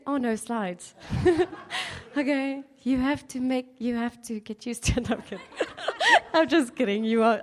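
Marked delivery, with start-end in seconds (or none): 1.0-2.1 light laugh. Real, not acted. Laughing at her own joke. 5.5-6.3 high giggle. Real, not acted. Laughs after kidding audience.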